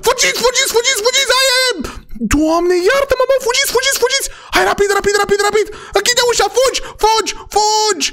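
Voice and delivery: high, frantic screeching to flee; hammed-up high-pitched panic yelling; panicked squeal. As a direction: shriek at everyone to run, in a pretend panic. Fleeing in panic from the teacher.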